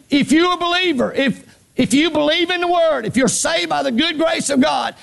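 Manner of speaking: high-pitched, fervent repeat to the congregation; impassioned preacher at full pitch; full-force preaching. Real, not acted. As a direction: cry it out so they hear you. Repeats it after asking for response.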